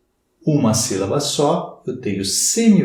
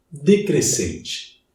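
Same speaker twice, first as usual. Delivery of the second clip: "decrescente" drawn out slowly, pitch sinking at the end; slow falling demo of the word; falling tune demo; 5 semitones higher, much slower than usual. Shown as a demonstration. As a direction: let your voice drop through "decrescente". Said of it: "a minha voz vai cair".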